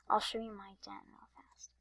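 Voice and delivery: soft unvoiced whisper. Real, not acted. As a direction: whisper it to the viewers. Whispered aside.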